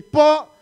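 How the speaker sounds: "Po" punched out high and loud; emphatic respectful "Po". Shown as a demonstration. Father teaching the Filipino respectful word, emphatic "Po". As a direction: hit "Po" hard as the model word. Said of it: "We say".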